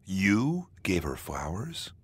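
slow question with "you" drawn out and punched; stress on YOU. Shown as a demonstration. Narrator showing how stress changes meaning, stress on "you". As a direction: lean on "you", questioning who gave them. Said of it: "By changing the stress, you change".

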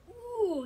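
high, pained mock ooh; a mocked group "ooh" of sympathy pain; imitated crowd wince. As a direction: squeal like the audience cringing. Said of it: "And everybody was".

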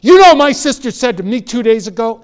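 loud, high imitation of an angry girl; really angry. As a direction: burst out in acted indignation. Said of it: "She got really angry".

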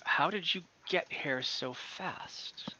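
slow whispered amazement; shocked whisper. Acted. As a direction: breathe the question in awe.

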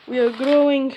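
loud booming shout of the slogan. Acted. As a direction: belt out the slogan loudly.

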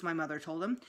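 ordinary delivery, nothing marked

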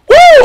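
high, loud celebratory whoop. Real, not acted. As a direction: cheer as the tree drops. Shelter done, celebrating.

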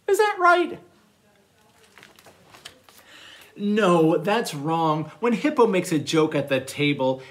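animated storytime narration with big swings in pitch; reading to children. Acted. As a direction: read a playful picture book to little listeners. Reads manners story to children.

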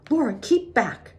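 put-on high, sharp command to a child; fierce motherly order; fierce warning. A narrator's reading. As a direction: order her back fiercely, pitch jumping up. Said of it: "Ma said fiercely".

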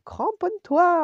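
high, loud shouted command; urgent order. A narrator's reading. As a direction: call out a warning mid-ride. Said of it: "ordonne Clochard". (ordre crié, aigu et fort)

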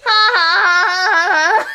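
loud, spelt-out mock laugh; hammed-up laugh imitation; imitating friend's laugh. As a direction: belt out fake ha-ha-has. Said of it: "you just go".

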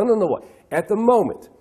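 normal talking voice

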